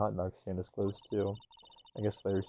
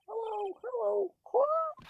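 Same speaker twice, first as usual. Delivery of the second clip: parrot squawk; slower than usual. Acted. Parrot greeting visitors at the empty house, squawking. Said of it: "Hey, it's Polly".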